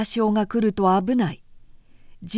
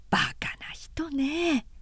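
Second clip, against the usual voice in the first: whispered aside; 7 semitones higher, 6 dB quieter, slower than usual. A narrator's reading. Ohiro after sister leaves.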